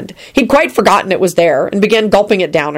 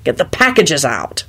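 usual speaking voice

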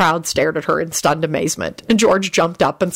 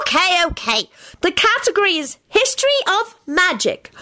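put-on high-pitched child's announcement; high, dramatic quiz-caller voice; excited announcement; 13 semitones higher than usual. A narrator's reading. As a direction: proclaim it high to an impatient crowd. Child announces card to shouting players.

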